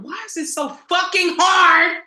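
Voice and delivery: high, loud, exasperated shouting; a loud, exasperated cry of why is it so hard; frustrated outcry. Retold or imitated. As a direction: vent the struggle to the heavens. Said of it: "y'all that have been wondering".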